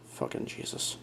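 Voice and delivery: whispered curse, barely audible; under-breath curse. Real, not acted. Radio hobbyist grumbling to himself about last month, whispered curse.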